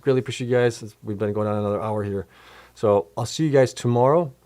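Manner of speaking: ordinary talk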